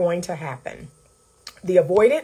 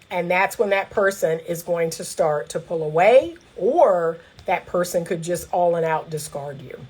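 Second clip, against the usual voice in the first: usual speaking voice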